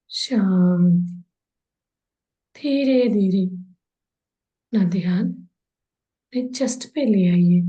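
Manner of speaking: unhurried body-scan relaxation, long pauses between phrases; guided meditation. Real, not acted. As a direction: guide them into calm, drawing out the soothing words. (ठहर-ठहर कर, शांत भाव से निर्देशित ध्यान कराते हुए)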